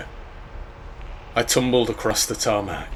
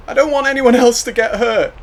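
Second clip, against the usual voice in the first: anguished; 9 dB louder, faster than usual. Acted. Boy begging rescuers to leave.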